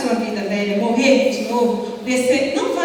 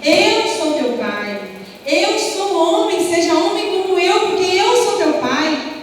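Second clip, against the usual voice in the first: higher, pleading father's voice, slow at the start; desperate father; 6 semitones higher than usual. Retold or imitated. Father tells his arrested son to be a man, pleading. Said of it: "E ele falava".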